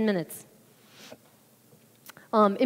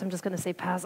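softer, dropped repeat of pass; muttered to herself; 3 semitones lower, 9 dB quieter than usual. Retold or imitated. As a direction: say it again under your breath. Repeats it under her breath.

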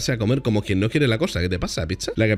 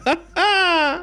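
high-pitched burst of laughter; carcajada aguda. Real, not acted. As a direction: cackle high at the grim warning. Laughs at the dark warning. (risotada muy aguda)

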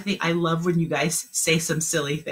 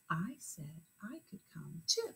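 whispered, much softer; 19 dB quieter than usual. Shown as a demonstration. Teacher repeating the sentence softly, whispered. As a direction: whisper it back. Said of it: "say it back in your head".

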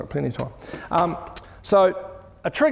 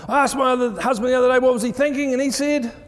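high, fast mock wife's complaint; light imitation of an exasperated wife; 8 semitones higher, 3 dB louder than usual. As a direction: play the fed-up wife, higher and quicker. Wife's complaint voiced in story.

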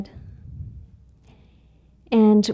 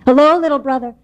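higher, louder hearty hello; booming raccoon greeting; 5 semitones higher, 6 dB louder than usual. Acted. Raccoon greeting the little mouse, loud and hearty. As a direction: call out big and friendly.

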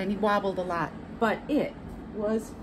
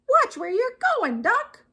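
high chicken voice; indignant chicken; 14 semitones higher, 6 dB louder than usual. A narrator's reading. Chicken silently scolding Duck for riding at her, indignant chicken. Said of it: "what she thought was".

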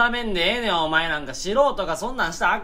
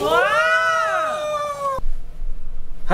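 high shrieking wail of alarm; a sudden high scream; 3 dB louder, much slower than usual. Real, not acted. Screaming at the plates.

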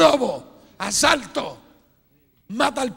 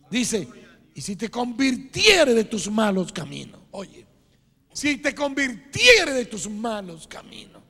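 ordinary talk

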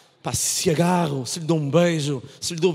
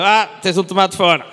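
loud shouted remark; calling out; 5 dB louder than usual. Retold or imitated. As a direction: call it out across the room. Woman calling out in audience.

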